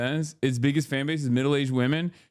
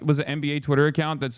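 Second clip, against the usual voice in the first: usual speaking voice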